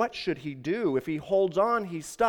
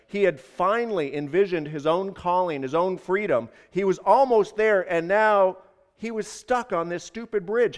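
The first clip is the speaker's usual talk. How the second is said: usual speaking voice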